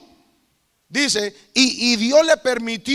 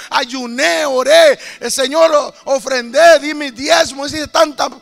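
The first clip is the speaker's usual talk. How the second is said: loud, high, insistent begging; desperate screamed plea; 4 semitones higher, 5 dB louder than usual. Retold or imitated. Damned servant begging God to release her, desperate shouted plea. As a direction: cry out every deed as a plea.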